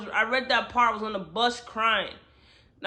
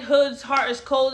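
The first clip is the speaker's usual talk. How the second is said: normal talking voice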